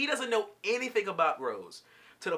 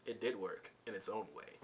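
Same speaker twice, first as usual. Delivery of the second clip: smooth, low and very soft; imitated smooth, unruffled low voice; Garnet; 8 semitones lower, 13 dB quieter than usual. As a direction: play it cool, quiet and low, after a tiny chuckle. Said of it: "Garnet all smooth".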